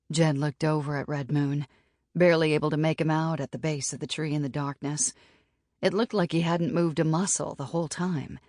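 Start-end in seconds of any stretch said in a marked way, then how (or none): none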